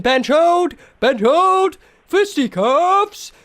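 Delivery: loud, high, drawn-out battle cry; shouting. Pretend. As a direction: yell it like a boxer hyping the crowd. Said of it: "I will be shouting".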